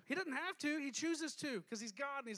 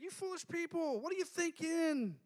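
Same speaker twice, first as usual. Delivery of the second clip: higher, light friendly chiding; fond exasperation; 7 semitones higher than usual. Retold or imitated. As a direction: tease them like old friends. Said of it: "I don't think he said it that way".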